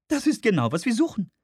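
hoch gesetzte Frauenstimme; Frauenfigur. Acted. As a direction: spiel eine Frau in hoher Lage. Woman voiced in narration. (hohe Frauenstimme)

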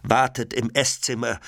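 ordinary delivery

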